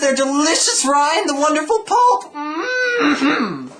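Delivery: high, loud, slow rapture; loud high ecstatic longing; swooning over oranges. Acted. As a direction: savour every word, ecstatic. Dimitri rhapsodizes about oranges.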